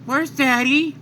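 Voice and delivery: put-on high child's voice; little Goten. Goten asking his mom for Daddy, high childlike. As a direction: ask like a small boy, high and pleading. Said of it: "runs up to his mom and says".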